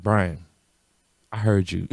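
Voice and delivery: low, soft mom voice; imitated knowing mom. Mom catching son in a lie, low knowing voice. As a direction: say it low, with quiet certainty. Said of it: "she's like".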